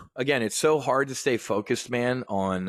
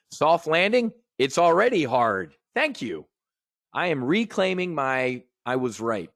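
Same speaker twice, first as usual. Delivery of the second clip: ordinary delivery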